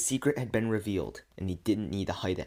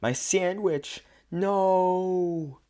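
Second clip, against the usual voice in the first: high, drawn-out dramatic wail; long, high cry of outrage; loud yell; 10 semitones higher, 5 dB louder, much slower than usual. A narrator's reading. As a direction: yell it slowly, pitched way up. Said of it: "River yells loudly".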